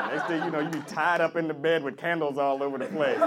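normal talking voice

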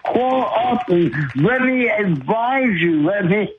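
ordinary talk

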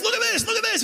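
high, eager rush to show friends; excited. Retold or imitated. Showing friends dating app photos, high and eager. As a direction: thrust the phone at friends, thrilled.

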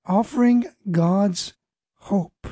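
ordinary talk

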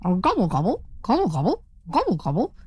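rippling turkey gobbles, rising at the end; turkey gobbling. A narrator's reading. Turkey struts around the barnyard, gobbling. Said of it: "as he strutted around the barnyard saying".

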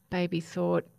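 ordinary delivery, nothing marked

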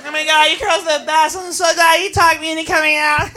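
usual speaking voice

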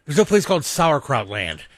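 usual speaking voice

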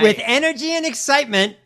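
high, loud overexcited parody; over-the-top enthusiastic mimicry; mock peppy read. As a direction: ham up the cheery press read. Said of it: "if this is your read on it".

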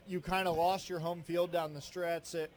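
ordinary talk, nothing marked

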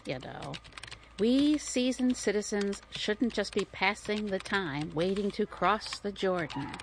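usual speaking voice

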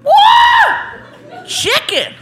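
hammed-up thrill of a man opening a gift basket; excited man. Man discovering basket items, overjoyed and shouting.

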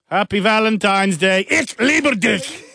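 high, slow, barked Valentine greeting; hammed-up German shout of love; harsh German lover. Host as German suitor wishing Happy Valentine's, harshly.

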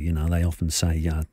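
normal talking voice

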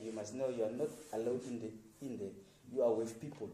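usual speaking voice

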